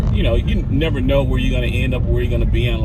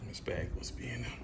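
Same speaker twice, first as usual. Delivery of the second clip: whisper; 19 dB quieter than usual. Real, not acted. Quietly loading car, child nearby.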